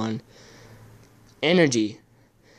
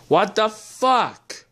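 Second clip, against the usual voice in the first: slow, higher exasperated curse; frustrated swearing; 6 semitones higher, much slower than usual. Real, not acted. Fighting the phone while filming, frustrated. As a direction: drag it out in disbelief. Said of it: "So I'm swearing because it's difficult".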